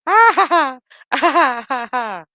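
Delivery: loud, high cackling laugh that fades off; mocking laugh. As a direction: laugh at him as if he is being silly. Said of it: "and she said".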